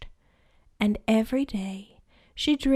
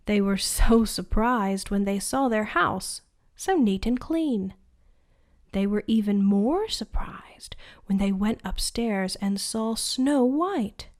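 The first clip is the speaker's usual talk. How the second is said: plain reading voice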